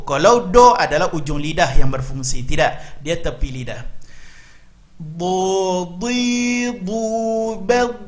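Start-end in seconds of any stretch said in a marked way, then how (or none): 4.9-8.0 slow Quran recitation drill. Shown as a demonstration. Teacher leading students in a slow drill. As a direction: chant each syllable slowly and clearly.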